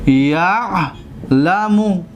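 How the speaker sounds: یعلم drawn out very slowly, split after the first syllable; slow syllable demonstration. Shown as a demonstration. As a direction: stretch the first syllable of یعلم, pause, then finish it. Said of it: "دقت بتید".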